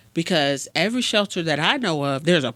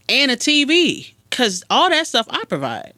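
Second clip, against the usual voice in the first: higher, louder parental lecture; indignant parent, pitched up; acted exasperated parent; 8 semitones higher, 3 dB louder than usual. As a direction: drive it home like the one paying bills. Acted parent lectures idle kid.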